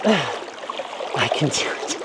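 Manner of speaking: breathy, slow self-reassurance; breathy self-talk. Real, not acted. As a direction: breathe it out slowly to yourself. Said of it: "breathy pep talk after sigh".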